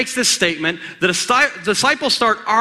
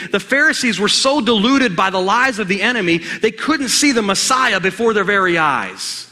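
ordinary delivery

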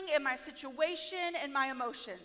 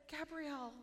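gentle Holy Spirit; 3 semitones lower, 15 dB quieter than usual. Retold or imitated. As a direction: ask softly, like coaxing a child. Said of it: "And Holy Spirit said".

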